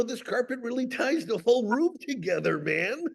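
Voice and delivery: high, softened laid-back dude voice; Jeff Bridges. Acted. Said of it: "my Jeff Bridges".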